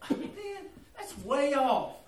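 high, laughing brush-off; breezy dismissal. Retold or imitated. Fisherman to himself about the storm, breezy dismissal. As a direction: wave it away lightly.

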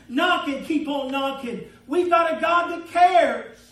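loud, ringing proclamation building in volume; forceful preaching. Acted. Preacher urging the congregation to keep asking, forceful preaching. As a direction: preach it out, stretching the last words.

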